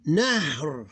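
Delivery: "Nahr" as one syllable, no vowel after the r; drawn-out "Nahr", h and r run together; sukun ending. Shown as a demonstration. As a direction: drop the last vowel and hold the r in "Nahr". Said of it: "read it as like if it have sukun".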